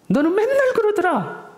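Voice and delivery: shrill-pitched mock put-down; belittling. Shown as a demonstration. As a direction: put them down with a high jeer. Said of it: "상대방을 깎아내리는 거죠".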